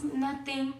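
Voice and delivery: put-on child's soft mumbled answer; lightly voiced son, hushed and withdrawn; a hint of a dazed little boy, quiet; small quiet voice.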